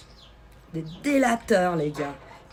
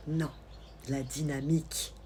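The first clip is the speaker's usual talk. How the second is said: ordinary talk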